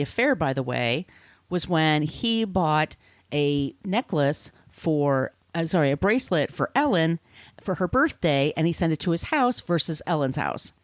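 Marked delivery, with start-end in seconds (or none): none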